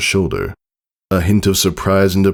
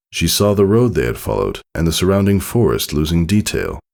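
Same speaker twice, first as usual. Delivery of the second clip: plain reading voice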